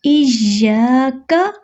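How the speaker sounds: very slow melodic tajweed recitation; Quran recitation. Shown as a demonstration. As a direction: draw it out as tilawat. Continues recitation. (धीमी तिलावत)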